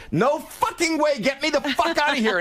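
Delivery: frightened man yelling to be let out; panicked yelling. Retold or imitated. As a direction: scream to get out of the room. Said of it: "And they go".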